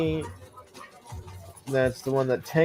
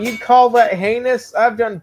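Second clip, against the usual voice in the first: higher, louder scoffing question; mock indignation; 6 semitones higher, 6 dB louder than usual. Real, not acted. Player mocking the game's backstab message, scoffing and loud.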